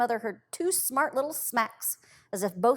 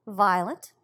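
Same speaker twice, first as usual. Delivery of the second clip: usual speaking voice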